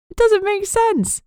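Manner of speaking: words shaking through tears; crying to Oscar, high and slow. Acted. Amber still crying.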